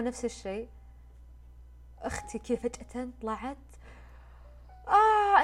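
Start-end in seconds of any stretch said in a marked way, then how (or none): 4.9-5.4 high, loud crying wail; imitating her sister bursting into tears; sister's sob. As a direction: break down into a wail. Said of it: "انهارت صياح".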